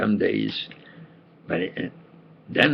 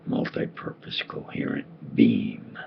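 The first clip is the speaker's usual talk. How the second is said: whispered; 13 semitones lower, 11 dB quieter than usual. Shown as a demonstration. Repeats laser description.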